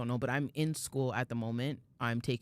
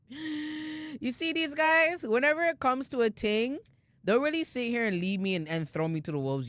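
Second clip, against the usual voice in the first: mock accusation; 9 semitones higher than usual. Teasing co-hosts for abandoning her.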